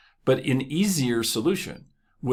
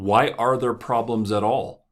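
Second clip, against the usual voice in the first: ordinary talk, nothing marked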